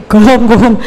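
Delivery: laughing mimicry of Javanese-accented "go hom"; mock "go home" with thick Javanese o's; Javanese-style pure o, amused. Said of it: "Nggak ada go home".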